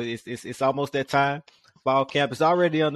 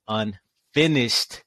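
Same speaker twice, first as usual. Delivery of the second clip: Unfinished stretched very slowly and falling; Unfinished said slowly, weighing each syllable; slow, drawn out; much slower than usual. Shown as a demonstration. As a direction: drag out every syllable of Unfinished. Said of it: "Let me say that again slowly".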